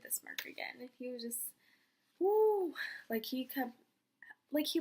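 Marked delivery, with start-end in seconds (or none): 2.2-2.7 high, loud "woo"; swooning whoop. Retold or imitated. Fan reacting to idol's smirk, swooning.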